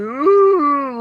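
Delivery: high, loud retold ooh of disgust; imitating onlookers going ooh; mock disgusted ooh. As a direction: squeal it like someone grossed out.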